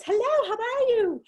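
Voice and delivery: high, slow, sing-song realtor greeting. Retold or imitated. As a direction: greet a client in a high bright sales voice. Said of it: "she's got her realtor voice".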